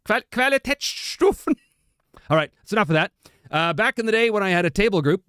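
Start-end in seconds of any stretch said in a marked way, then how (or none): 0.1-1.5 high, slow "Qualitätsstufen" with "tet" for "tät"; umlaut on the A. Shown as a demonstration. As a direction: stretch out "Qualitätsstufen", German ä as "eh". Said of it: "there's an umlaut over the A".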